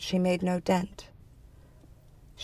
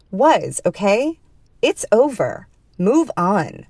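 louder, higher, emphatic insistence; exasperated dismissal; 4 semitones higher, 4 dB louder, slower than usual. A narrator's reading. The other telling woman it's over, exasperated. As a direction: shut the argument down firmly.

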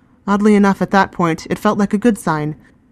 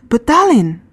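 put-on alarmed girl; 7 semitones higher, 4 dB louder than usual. A narrator's reading. Reka protests again, louder.

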